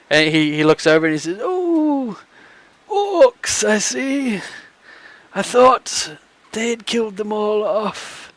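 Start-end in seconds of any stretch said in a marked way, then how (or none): 1.4-8.3 put-on high, soft, slow old man; a doddering old man welcoming them; frail old man voice. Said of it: "a frail voice".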